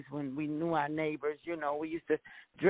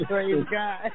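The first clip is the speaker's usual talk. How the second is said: laughing. Real, not acted. Woman laughs at pastor's ten grandkids, laughing. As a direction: praise God through a laugh.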